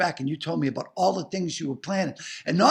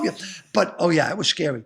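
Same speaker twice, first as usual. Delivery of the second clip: ordinary talk, nothing marked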